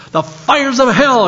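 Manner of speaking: a retold shout of righteous fury, only half voiced; hammed-up preacher's yell. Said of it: "he yelled".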